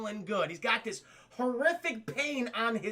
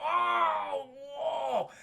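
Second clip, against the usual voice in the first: high acted wail of pain; cry of pain; 7 semitones higher than usual. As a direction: cry out as pain stabs the side. Hero hit by horrific pain.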